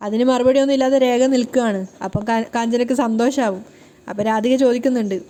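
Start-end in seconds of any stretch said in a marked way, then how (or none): none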